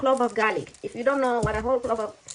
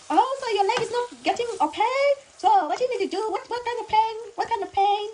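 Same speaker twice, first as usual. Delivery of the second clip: put-on high, loud Chinese-accented doctor; high-pitched mock Chinese accent; imitated Chinese accent; 12 semitones higher, 4 dB louder than usual. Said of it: "making her accent".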